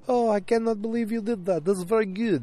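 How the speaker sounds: put-on high, sing-song Father Guido Sarducci-style accent; high-pitched au pair in a botched foreign accent; muddled foreign accent. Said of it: "gonna stop doing accents when I'm tired".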